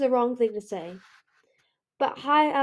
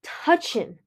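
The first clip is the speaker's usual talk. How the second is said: choked fury, raised pitch on the opening words; choked-out threat; 4 semitones higher, 8 dB louder, slower than usual. A narrator's reading. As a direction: force it out in broken, punched pieces. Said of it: "Ruby choked out".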